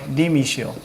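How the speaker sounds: 님이시여 repeated softly and falling. Shown as a demonstration. Teacher repeats 님이시여 to students, softly. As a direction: say 님이시여 quietly to yourself. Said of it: "그러니까 높임이에요".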